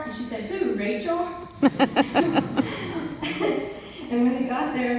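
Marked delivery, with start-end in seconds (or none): 0.5-1.3 put-on puzzled kid voice. Kid's puzzled reply retold. 1.3-4.1 loud, high burst of laughter; laughing at the memory; bright real laugh. Real, not acted. Laughing at the kid's line.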